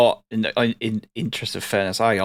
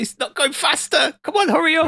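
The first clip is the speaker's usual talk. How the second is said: impatient high voice. Retold or imitated. Impatient in-game quote, high voice.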